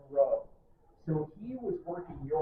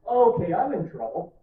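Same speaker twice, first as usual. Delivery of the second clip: alarmed realization; 4 semitones higher, 11 dB louder than usual. Retold or imitated. Firefighter realizes he's trapped.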